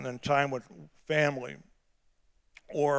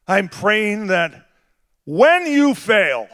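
slow, building, higher and louder sermon voice; preaching at full force; 5 semitones higher, 6 dB louder, slower than usual. Acted. Pastor voicing Jesus's promise, rising and slow. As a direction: swell it up to the congregation.

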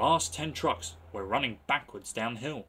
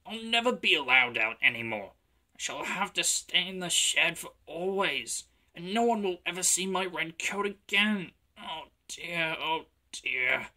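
sorrowful, higher-pitched lament; sad; 6 semitones higher than usual. A narrator's reading. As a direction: wail gently like a miserable little engine. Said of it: "he thought sadly".

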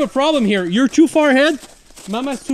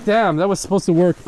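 normal talking voice

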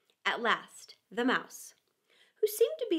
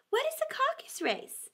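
high Alice voice. Acted. Alice asks.

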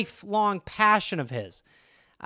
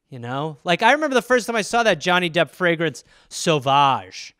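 normal talking voice

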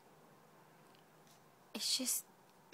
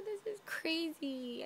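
ordinary talk, nothing marked

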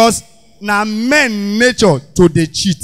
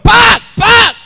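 high barking shout of park; police shout; 12 semitones higher, 6 dB louder than usual. Retold or imitated. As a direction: yell it like a traffic cop. Police stopping the car.

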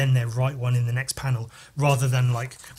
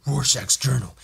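gruff, raspy growl; Batman. Acted. Comic reader redoing Rorschach's journal as Batman, raspy growl. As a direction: growl the journal entry like a masked vigilante. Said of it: "He sounds like Batman".

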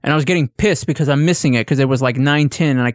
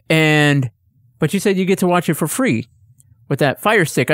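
ordinary delivery

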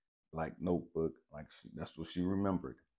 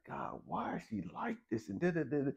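acted-out complaint to God; mad at God; 11 semitones higher than usual. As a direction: plead upward in retold frustration. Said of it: "I'm just mad at God".

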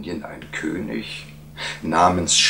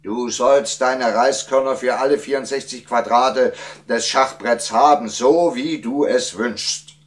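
higher, indignant royal decree; annoyed king; 8 semitones higher, 4 dB louder than usual. A narrator's reading. King grudgingly granting the wise man's wish, annoyed. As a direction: grant it with open irritation. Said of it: "rief der König verärgert".

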